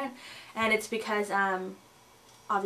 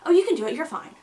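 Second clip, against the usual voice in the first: mock breezy encouragement, higher and louder on the first words; dismissive cheer; 7 semitones higher, 6 dB louder, faster than usual. Mocking people who brush off a pregnant woman's tiredness, breezy. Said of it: "And they'll be like".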